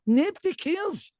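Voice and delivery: "Nobody cares" high and slow, each word leaned on; emphatic rant. Real, not acted. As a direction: hammer it home, exasperated. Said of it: "ranting about dull speeches".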